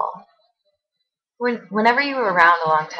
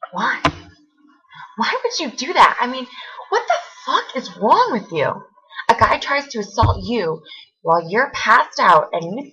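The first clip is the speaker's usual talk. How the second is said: raised pitch, incredulous outrage; angry confrontation; 4 semitones higher than usual. Acted. Confronting friend about what she did, angrily incredulous. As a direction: demand an answer, voice climbing in disbelief.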